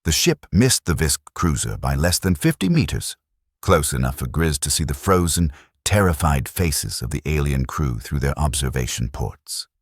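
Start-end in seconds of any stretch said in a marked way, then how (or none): none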